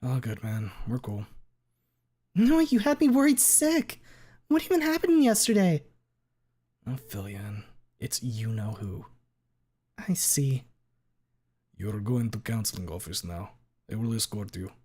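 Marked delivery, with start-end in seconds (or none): 2.3-5.8 put-on very high, worried girl; anxious schoolgirl. Tiffany high voice. 11.8-14.7 put-on flat stern Russian accent. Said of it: "Russian accent teacher".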